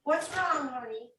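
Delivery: high, soft coaxing pet voice; tender to puppy. Real, not acted. Owner calming her frightened puppy upstairs, high and soft. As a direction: soothe a scared little dog gently.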